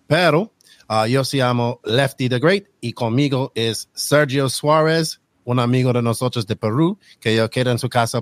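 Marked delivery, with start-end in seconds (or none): none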